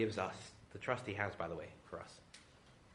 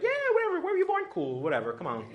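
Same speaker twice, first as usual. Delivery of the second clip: breezy casual welcome; 8 dB louder than usual. Retold or imitated. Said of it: "It's like".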